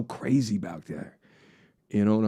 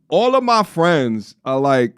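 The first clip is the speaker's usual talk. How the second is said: ordinary delivery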